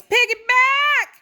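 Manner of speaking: high, loud, drawn-out mock yell; gleeful drunken yell; yelling piggyback. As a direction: shriek it like a drunk friend running. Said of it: "and yells".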